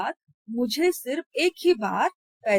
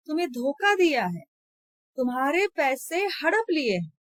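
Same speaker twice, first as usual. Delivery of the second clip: almost shouting; 5 semitones higher than usual. Acted. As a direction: rage at her for thanking him. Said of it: "ऊंचे स्वर में लगभग चिल्लाते हुए". (लगभग चिल्लाते हुए, गुस्से में)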